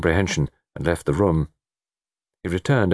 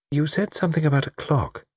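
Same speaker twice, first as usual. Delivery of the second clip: high woman's character voice; lightly voiced hesitant lady; thoughtful lady; 9 semitones higher than usual. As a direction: venture it tentatively, pitched high. Laura speaks.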